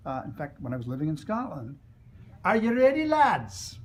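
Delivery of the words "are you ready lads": put-on loud, high call to the boys; a race master booming at the lads. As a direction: boom it out to the starting line. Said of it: "the master's voice boomed".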